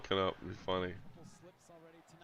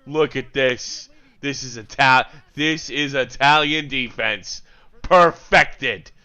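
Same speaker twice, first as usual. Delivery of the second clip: loud, slow, drawn-out praise; big, savoured exclamations; emphatic admiration; 4 semitones higher, 12 dB louder, slower than usual. Real, not acted. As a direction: belt it out slowly like an awed commentator. Fan hails a defensive play.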